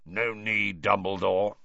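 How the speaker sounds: put-on growled, wheezy old voice. A narrator's reading. Moody turning up to answer Dumbledore, wheezy growl. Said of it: "said a wheezy growl".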